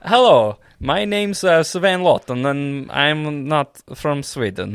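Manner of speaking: high, sing-song mock Swedish; hammed-up Swedish accent. Shown as a demonstration. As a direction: introduce yourself in a slow Swedish lilt. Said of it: "I love your Swedish one".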